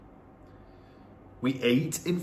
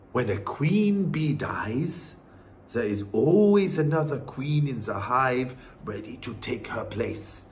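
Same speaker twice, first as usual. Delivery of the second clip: wise Norwegian grandmother. Acted. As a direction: explain patiently as the grandmother. Grandmother explains to boy.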